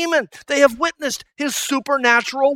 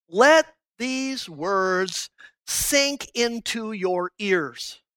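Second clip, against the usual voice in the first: each word laid down slowly and weighted; Jesus' solemn charge to his disciples, slow and weighty; slow emphatic quote; much slower than usual. Acted. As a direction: press every word home, slowly. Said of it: "It gives emphasis to what he's saying".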